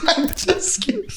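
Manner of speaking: giggling through it; laughing. Real, not acted. Laughing at a joke.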